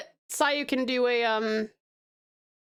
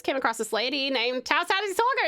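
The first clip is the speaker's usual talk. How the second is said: ordinary talk, nothing marked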